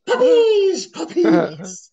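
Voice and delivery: high, loud, laughing shout of glee; King's gleeful cry. Retold or imitated. Commentator ogling the women, shrieking with glee. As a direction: whoop it like a giddy old commentator. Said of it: "the king is beyond happy".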